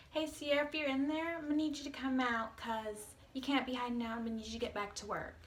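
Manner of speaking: high, loud mock-manager voice; exaggerated high-pitched boss impression; imitating scolding manager. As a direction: call out like a boss summoning a hiding employee. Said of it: "came at me crazy like that".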